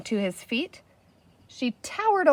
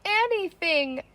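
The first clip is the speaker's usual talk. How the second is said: whimpering; 8 semitones higher, slower than usual. A narrator's reading. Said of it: "whimpered the chief".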